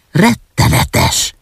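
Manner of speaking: slow, drawn-out inner outcry, hushed; high onset sinking into a near-voiceless tail; said to oneself. A narrator's reading. Orphan repeating it to herself in horror, slow and hushed. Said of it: "mondta magában az árva".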